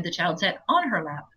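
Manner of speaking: "on" picked out against the text's word; stress on ON. Shown as a demonstration. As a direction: lean on "on" in the phrase. Contrasts misreading with text wording.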